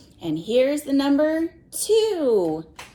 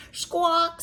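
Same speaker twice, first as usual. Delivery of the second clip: mother parrot squawk, pitched higher; lightly voiced parrot mother, higher; 6 semitones higher than usual. Reading to a child, mother parrot squawks higher. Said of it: "said the mother".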